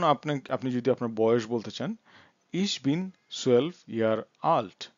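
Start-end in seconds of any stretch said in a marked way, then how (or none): 2.5-4.9 very slow, word-by-word German; slow language demo. Shown as a demonstration. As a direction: space each word out for learners. Teacher models stating one's age. (শব্দে শব্দে খুব ধীরে)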